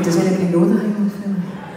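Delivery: laughing call to the crowd; half-laughing shout to the room. Pretend. Calling out to audience, laughing.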